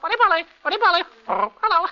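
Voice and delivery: squawky, very high parrot voice. Shown as a demonstration. Actor demonstrating a radio animal role, parrot. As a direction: mimic a talking parrot. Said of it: "called upon to do a parrot".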